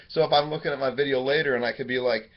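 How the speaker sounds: ordinary talk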